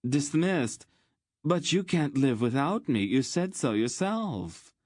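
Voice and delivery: high, pleading whine; wounded, high-pitched disbelief; aghast protest. Acted. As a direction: protest like a jilted favorite. Cast-off lover protests his dismissal.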